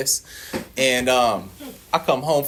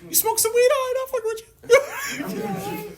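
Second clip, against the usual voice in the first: squeaky high voice breaking into laughter; boss laughing. Retold or imitated. The boss cracking up at his salesman, squeaky high. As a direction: squeal it through helpless laughter. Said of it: "He was like".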